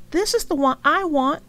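bright, loud and high; proudly claiming his choice; eager child. A narrator's reading. Franklin to his mother, eager and bright. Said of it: "he said".

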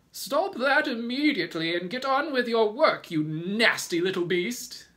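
high, loud scolding command; furious order. A narrator's reading. Aunt Sponge ordering crying James back to work, furiously. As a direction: order the child about at a shrieking pitch. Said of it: "Aunt Sponge ordered".

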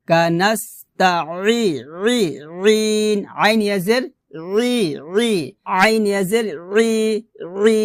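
throat-squeezed ee heard as রি; pressed ayn. Shown as a demonstration. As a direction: push রি out from mid-throat as Arabic ayn. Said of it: "গলার মাঝখান থেকে চাপ দিয়ে".

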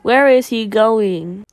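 slow, loud Where is he going; drawn-out question. Real, not acted. Gamer repeating the question to viewers, slow and loud. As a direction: stretch out each word of Where is he going. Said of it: "Oh, sorry".

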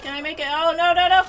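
rising loud panicked cries; panicked shout. Real, not acted. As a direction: shout in dismay as it fails. Failing in game.

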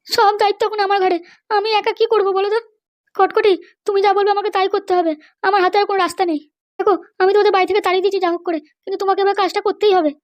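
a high female character voice; mother's voice. Acted. Mother's burden speech.

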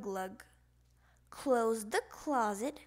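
ordinary delivery

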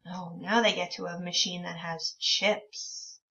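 ordinary talk, nothing marked

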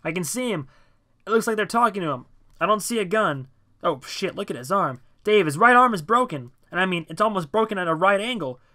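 high, loud, excited calling out; shouted report. A narrator's reading. John on the statue to Dave below, urgent shouting. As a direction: shout a live report down to a friend.

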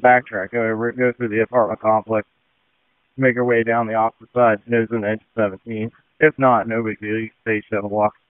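normal talking voice

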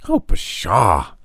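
put-on a touch of a boy's sulky scoff; disgusted boy. A narrator's reading. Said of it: "Tom was disgusted".

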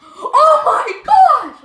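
drawn-out, very high and loud shriek; ecstatic scream. Real, not acted. Kid screaming at a huge pull. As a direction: scream it out in disbelief. Big pack pull reaction.

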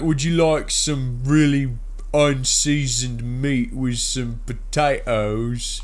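loud, high, slow hammed-up British accent; mock British accent. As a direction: drawl it out like a caricature Brit. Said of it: "British people ... They're like".

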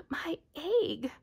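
high shout of joy. A narrator's reading. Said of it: "shouted Horton".

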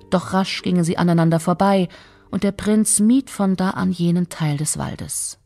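plain reading voice

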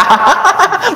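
a breathy, high giggle of delight; light high-pitched titter; giddy high giggle. Retold or imitated. Eager listener laughing.

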